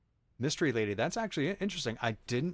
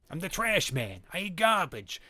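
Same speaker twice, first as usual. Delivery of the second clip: higher, nasal-free mock Trash Man rasp; Danny DeVito Trash Man; 6 semitones higher, 4 dB louder than usual. Streamer shows the one DeVito bit they can do. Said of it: "Danny DeVito voice... Trash Man I can do".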